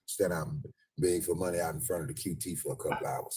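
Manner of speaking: normal talking voice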